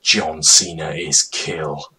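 ordinary talk

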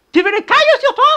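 loud high mock threat with a laugh; threatening the dog. (menace amusée au chien)

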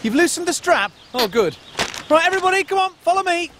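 normal talking voice

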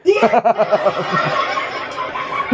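laughing at his own line; soft laugh. Real, not acted. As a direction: chuckle it out.

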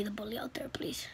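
soft whispered request; whispered plea. Real, not acted. Kid ending his vlog, whispering to viewers. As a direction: hush it into a plea. Sudden unvoiced closing plea.

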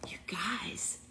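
soft, spent whisper after laughing; very quiet, voiceless aside. Real, not acted. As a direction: whisper it to the audience, recovering from laughter. Recovering from laughter, to audience.

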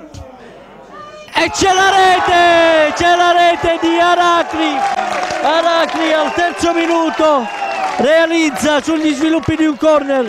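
shouted goal call, high and loud; breathless italian goal commentary. Real, not acted. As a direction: yell the goal, drawn out.

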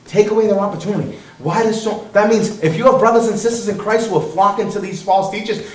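ordinary delivery

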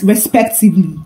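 ordinary delivery, nothing marked

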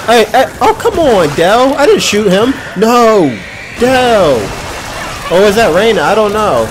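loud, high frustrated shouting; frustrated yelling. Real, not acted. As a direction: yell at your teammate mid-fight. Yelling at teammate during fight.

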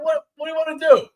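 high, mock-helpless voice, well above his own; mock exasperated self. Husband replays his own whiny answer to his wife, high mock voice. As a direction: throw up your hands in a squeaky whine. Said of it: "I'm like".

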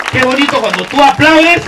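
normal talking voice

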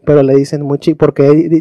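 normal talking voice

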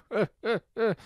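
loud, high hearty laugh; king's laugh. Acted. King laughs at talk of a giant, hearty laugh.

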